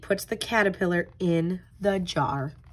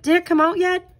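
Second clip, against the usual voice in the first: put-on high, eager little-girl question, louder; 9 semitones higher, 5 dB louder, faster than usual. Callie asks Pete about the caterpillar, high and eager. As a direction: ask like an impatient friend.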